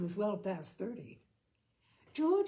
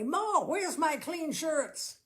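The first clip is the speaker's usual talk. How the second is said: higher, louder and slow, calling down the stairs; roared call; 8 semitones higher, 6 dB louder, slower than usual. A narrator's reading. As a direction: bellow it down to Ma. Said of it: "He roared down the stairway".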